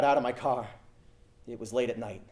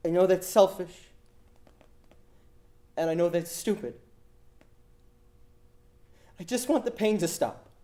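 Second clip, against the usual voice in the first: put-on much higher, pleading girl's voice; desperate girl pleading; 6 semitones higher, 4 dB louder than usual. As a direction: beg for the pain to end, voice near breaking. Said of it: "young, scared voice".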